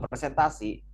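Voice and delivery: ordinary talk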